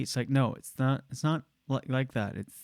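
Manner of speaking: ordinary talk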